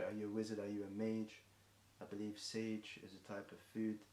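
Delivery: ordinary talk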